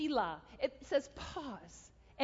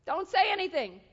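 insistent command; 6 semitones higher, 7 dB louder than usual. Retold or imitated. God repeats the order.

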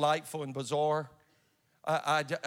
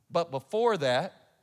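slow and loud on "But before that"; slow emphatic quote; 7 dB louder, slower than usual. Retold or imitated. Scene: the preacher quotes the prophet's warning, slow and loud. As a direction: draw out "before" with a rise. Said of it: "But he goes".

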